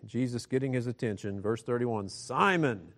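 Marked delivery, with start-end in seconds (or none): 2.1-2.9 builds louder to a ringing behold; exclamatory call. Shown as a demonstration. Preacher voices Jesus calling Simon, building to a shout. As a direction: call Simon's name to wake him, then boom behold. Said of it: "this is exclamatory".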